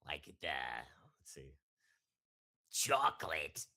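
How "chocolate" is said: put-on higher, louder girl voice, a bit deep; voicing a girl who hates chocolate. Shown as a demonstration. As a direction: lift into an androgynous girl voice. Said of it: "girl voice that sound vaguely, deep".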